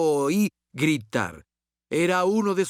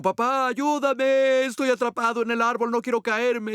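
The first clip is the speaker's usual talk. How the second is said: put-on much higher, pleading child's voice; scared little boy; 8 semitones higher than usual. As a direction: imitate a frightened four-year-old stuck in a tree. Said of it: "Él dijo".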